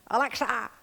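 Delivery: squawky high parrot call; mimicking a parrot calling for Alexa. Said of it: "The parrot would go".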